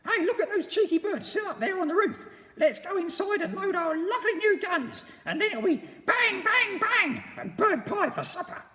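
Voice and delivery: put-on very high, loud, sneering old woman; cackling nasty wife; Missus Twit voice. A narrator's reading. Missus Twit sneering at the monkeys, shrill and loud.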